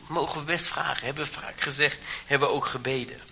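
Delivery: normal talking voice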